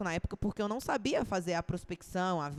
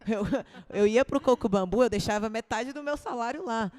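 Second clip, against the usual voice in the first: laughing; 3 semitones higher, 6 dB louder than usual. Real, not acted. Laughing at her own splurge.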